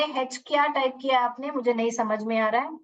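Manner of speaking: ordinary talk, nothing marked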